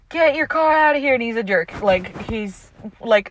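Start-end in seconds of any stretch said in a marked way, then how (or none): 0.1-1.1 mock angry order. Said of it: "So the guy's like".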